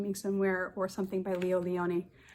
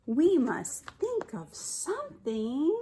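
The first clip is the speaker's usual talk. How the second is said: slow, higher, thoughtful fish voice; Swimmy; 6 semitones higher, 3 dB louder, slower than usual. A narrator's reading. Teacher voicing Swimmy thinking aloud, slow and higher. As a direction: muse it slowly like a brave little fish. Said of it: "said Swimmy".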